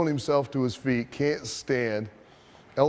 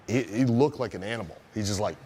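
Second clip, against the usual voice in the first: usual speaking voice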